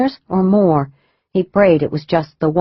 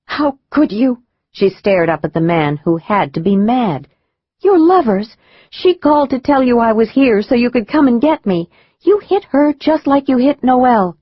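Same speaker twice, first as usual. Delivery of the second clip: put-on young woman voice; 5 semitones higher than usual. A narrator's reading. Sally confronts the madman.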